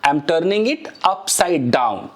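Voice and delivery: slow, careful English model sentence. Shown as a demonstration. Teacher modeling an English phrase slowly for students. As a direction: spell it out slowly for learners. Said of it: "मैं क्या बोल सकता हूं".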